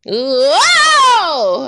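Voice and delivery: loud, high startled whoa. Retold or imitated. Vlogger reacting to the screamed note with a loud whoa. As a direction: yelp as if blasted by a scream. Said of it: "She screamed at us".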